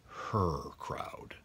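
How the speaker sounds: whisper. Acted. Hinting at the wizard relatives.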